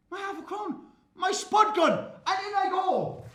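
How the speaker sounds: high shouted half a crown; excited shout. Acted.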